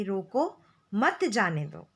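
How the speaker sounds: drawn-out रोको, break, then मत जाने दो as one phrase; pause after रोको. Shown as a demonstration. Teacher explains "do not let go", pausing after रोको. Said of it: "न जाने दो" की बात हो रही है".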